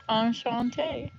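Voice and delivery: slow, careful enchanté, ahn-shahn-TAY in French; French greeting. Shown as a demonstration. Greeting new viewers the French way, slow enchanté. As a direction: draw out the French enchanté slowly. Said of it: "or as they say in French".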